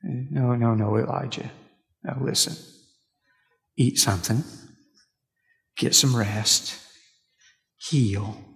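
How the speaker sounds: gentle murmur, easing off at the end; murmuring God. Retold or imitated. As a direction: soothe him like a tired child. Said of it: "God murmurs to him".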